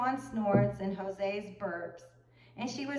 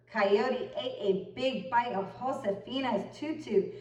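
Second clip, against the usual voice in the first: ordinary delivery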